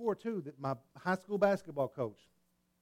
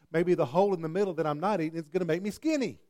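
ordinary talk

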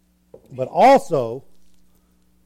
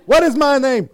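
loud, high mock scolding; angry parent; 8 semitones higher, 5 dB louder than usual. Parent confronting a child, mock shouted demand. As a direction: raise your voice at the wayward kid.

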